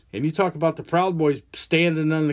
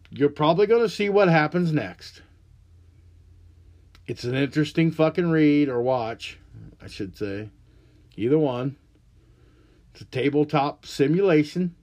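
ordinary delivery

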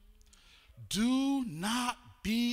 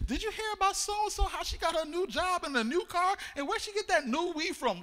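gossipy voice; 8 semitones higher than usual. Retold or imitated. Gossiping people quoted in sermon.

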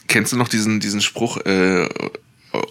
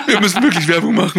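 high, laughing, fast joke; 10 semitones higher, 3 dB louder, faster than usual. Real, not acted. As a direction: push it out through laughter. Joking about podcast ads.

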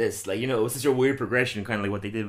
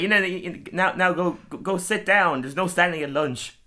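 higher, bossy teacher; 8 semitones higher than usual. Retold or imitated. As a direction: shoo her off like a strict teacher. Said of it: "she's like".

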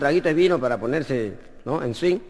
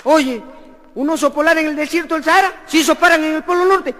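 incredulous friend; 9 semitones higher than usual. Acted. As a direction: call out the lie in disbelief. Incredulous friend questioning story. (incredulidad aguda y fuerte)

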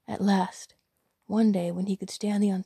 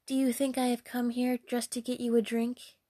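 higher, haughty question; loutish, haughty young damsel; arrogant; 4 semitones higher than usual. Acted. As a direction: look down on the person asking. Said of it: "said the loutish damsel arrogantly".

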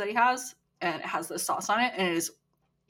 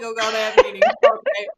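laughing mock complaint; 8 dB louder, faster than usual. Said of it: "you're like".

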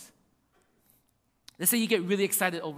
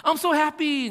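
mock happy; 6 semitones higher, 6 dB louder than usual. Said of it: "their joy can only go so deep".

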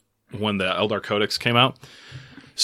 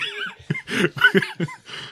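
high laugh; 3 dB quieter than usual. Real, not acted. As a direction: crack up helplessly. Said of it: "I did that perfectly".

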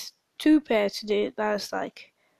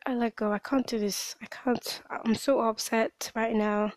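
usual speaking voice

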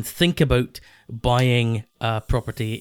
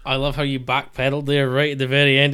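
ordinary talk, nothing marked

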